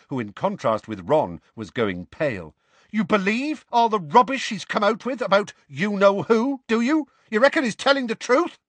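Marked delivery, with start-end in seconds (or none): 2.9-8.6 high, loud sneering challenge; angry accusation. A narrator's reading. Seamus challenging Ron about Harry, high and loud. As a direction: hurl the accusation.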